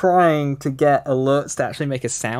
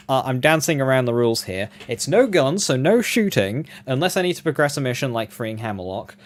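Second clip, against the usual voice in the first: ordinary delivery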